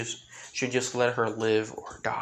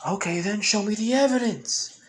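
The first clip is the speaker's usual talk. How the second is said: high, louder angry retort; angry yell; 9 semitones higher, 4 dB louder than usual. Acted. Aora yelling back at Izuku for proof, angry high shout. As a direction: snap back at him, pitched up and loud. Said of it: "yelling back at Izuku in an angry tone".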